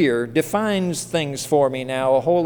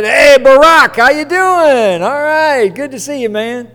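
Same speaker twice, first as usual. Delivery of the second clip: loud, high, brash buddy-buddy hail; hammed-up overfamiliar yell at the president; overly casual greeting; 8 semitones higher, 9 dB louder than usual. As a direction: holler it like an old pal. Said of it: "walk in there and say".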